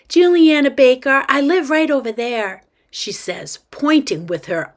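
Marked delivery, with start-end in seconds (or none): 0.1-2.6 put-on high, loud kid voice; forthright little girl. Girl introduces herself to mom, forthright kid voice. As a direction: announce yourself like a confident child.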